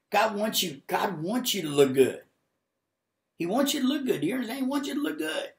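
high, emphatic preacher cry; fervent preaching. Real, not acted. Preacher urging viewers that God wants good for them, fervent. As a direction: drive it home, pitch climbing.

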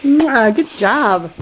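warm praise. Real, not acted. Kissing and praising child.

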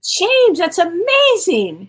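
high and loud, drawn-out amazement; amazed. Real, not acted. Host marveling at the book's success, amazed. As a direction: marvel at the news. Reacting to bestseller news.

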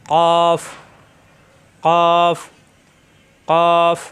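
firm model কফ; Arabic letter qaf. Shown as a demonstration. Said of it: "উপরে তালুতে চাপ দিয়ে".

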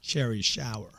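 cherry said as sherry, soft sh; cherry with a soft sh sound; sherry sour. Real, not acted. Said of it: "I can't even say it".

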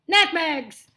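loud, sharp exclamation; lion's growl. Acted. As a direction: dismiss it as a cross lion. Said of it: "growled the lions".

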